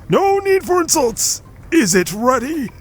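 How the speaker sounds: high-pitched, indignant character voice; squeaky protest. Acted. Voicing game character dialogue.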